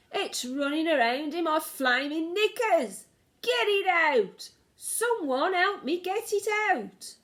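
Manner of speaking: high-pitched frantic yelling; man screaming for someone to help him. A narrator's reading. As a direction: yell in a panic, stretching the pleas. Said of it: "the man was screaming".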